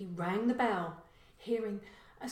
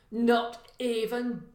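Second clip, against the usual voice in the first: slow, scornful drawl on the lock; contemptuous; 3 semitones higher, 8 dB louder, slower than usual. A narrator's reading. Burglar scorning the owners' lax security, slow and contemptuous. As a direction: sneer at the cheap door. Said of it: "he said contemptuously".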